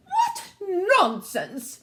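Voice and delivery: slow, high royal outrage; indignant queen. A narrator's reading. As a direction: draw it out as a haughty queen. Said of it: "cried the queen".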